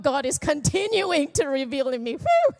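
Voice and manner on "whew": whew. Real, not acted. Relieved exhale after confession.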